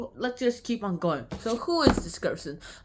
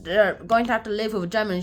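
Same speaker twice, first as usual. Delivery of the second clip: ordinary talk, nothing marked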